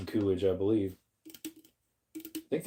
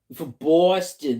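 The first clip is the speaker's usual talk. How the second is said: put-on Boston accent, more like Aussie; 3 semitones higher, 4 dB louder than usual. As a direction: try a playful Boston accent. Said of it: "your Boston accent sounds more like Aussie".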